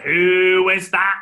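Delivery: loud, drawn-out demand; usher with torch. Retold or imitated. Cinema usher with torch hunting the shouter, stern call. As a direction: call out slowly to find the culprit. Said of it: "The guy with the torch is like".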